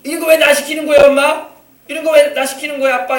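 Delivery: put-on loud, high protest of a defiant child; imitated back-talk. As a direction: act out a kid talking back to a parent. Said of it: "말대꾸 딱딱하면서 엄마한테 말이야".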